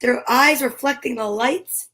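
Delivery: gentle storybook reading; story narration. Acted. Parent reading Eric Carle to a child, gentle storybook voice. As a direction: read the picture book to a little one. Reads picture book aloud.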